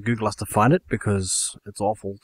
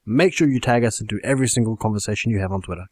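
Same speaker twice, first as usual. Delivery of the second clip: ordinary talk, nothing marked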